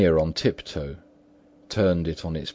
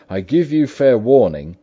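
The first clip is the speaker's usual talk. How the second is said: raised, ringing voice of warning; loud warning; 7 semitones higher, 9 dB louder than usual. A narrator's reading. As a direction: call a stern ultimatum through the door. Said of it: "with a loud voice".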